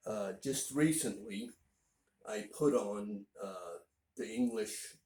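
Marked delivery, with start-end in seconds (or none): none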